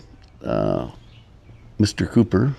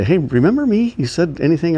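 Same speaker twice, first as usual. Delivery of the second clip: much higher, teasing pretend call; mock reminder; 8 semitones higher than usual. Pretend phone call reminding the assistant of her promise, teasing. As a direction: needle her playfully about the old promise.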